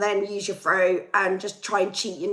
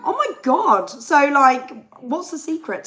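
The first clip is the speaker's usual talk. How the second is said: surprised; 5 semitones higher, 6 dB louder than usual. Real, not acted. Reacting to a viewer comment.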